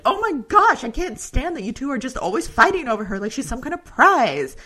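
hammed-up Nynaeve fed up with the bickering; exasperated outburst. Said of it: "And then Nynaeve is like".

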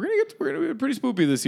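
way up high, playful; high silly voice. Acted. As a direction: squeak out spoopy. Cutesy spooky word, pitch jump.

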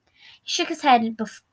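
ordinary delivery, nothing marked

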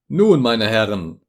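slow, loud, addressing an assembly; speechmaking tone. A narrator's reading. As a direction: orate like a lecturer to a hall. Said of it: "in einem Tone, als stünde er in einer Versammlung".

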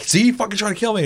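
high, loud, fast accusing cry; mock panicked yell. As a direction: sell the fake outrage of being attacked. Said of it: "I'm like".

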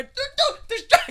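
very high squeaky dog voice; talking animal. Pretend. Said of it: "The dog's like".

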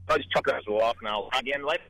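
ordinary talk, nothing marked

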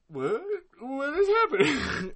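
mock bewildered listener, voice climbing high and slow; imitating confused Conan. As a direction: act out a baffled friend, drawn out. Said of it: "I know Conan's like".

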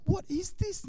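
creeped-out high what-is-this; spooked. Retold or imitated. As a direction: voice jumping up in nervous alarm. Spooked in dimmed office.

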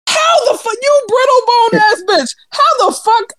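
high shouted disbelief; incredulous roast. Real, not acted. Friend roasting a friend who has osteoporosis, shouting. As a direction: shout the mockery, laughing.

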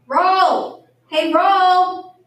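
high, loud holler; calling out. Acted. Literal-minded teacher calling to a bread roll, loudly. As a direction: call it like summoning someone. Now I have to call it.

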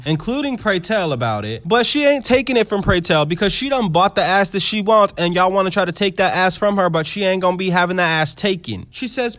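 usual speaking voice

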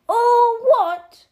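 huge high-pitched roar; thundering. A narrator's reading. Giant erupts at the boy's ignorance, thundering. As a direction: thunder it out at full outrage. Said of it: "Harry thundered".

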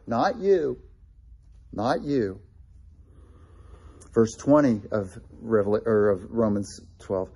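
usual speaking voice

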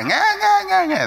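high, loud mock baby wail "nga nga"; baby crying. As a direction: imitate a newborn crying. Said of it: "and they cry".

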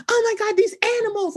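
high, put-on panic; hammed-up dismay; mock alarm. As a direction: imitate someone fussing in fake fright. Mimicking the contestant's put-on fright.

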